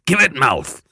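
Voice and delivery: loud rough convict order; gruff command. Acted. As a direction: bark at the boy to speak up. Convict demands louder answer.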